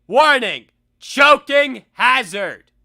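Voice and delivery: slow loud mock warning label. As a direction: announce it like a toy label. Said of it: "a toy that says".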